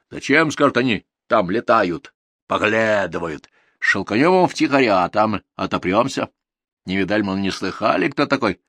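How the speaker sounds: animated peasant storyteller; grumbling villager mimicking others. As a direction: act out the imagined schemers.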